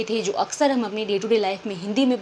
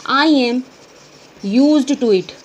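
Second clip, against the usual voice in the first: louder, clear teacher demo; loud model answer; 7 dB louder, much slower than usual. Shown as a demonstration. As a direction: say it out for students to copy. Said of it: "इसको कहेंगे". (छात्रों के लिए ज़ोर से साफ़ बोलकर)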